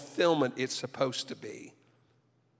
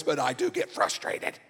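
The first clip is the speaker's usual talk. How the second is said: ordinary talk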